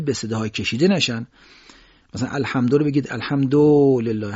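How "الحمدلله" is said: الحمدلله with du said as a long-held Persian o; stretched Persian o. Shown as a demonstration. Teacher proving a point to listeners, stretched Persian vowel. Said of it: "هر چقدر بکشید او تبدیل به او نمیشه".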